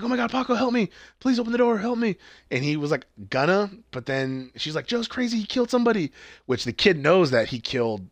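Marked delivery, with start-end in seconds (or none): 0.0-2.2 high frantic begging for help; mock panicked plea. Retelling Beck begging Paco through the gate, high and frantic. She's, like, screaming. 4.9-6.1 high desperate warning; mock frantic plea. Retelling Beck pleading with the kid, high and desperate.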